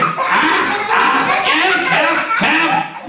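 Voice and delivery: hammed-up panic; high comic help calls; mock cry for help. Cries for help.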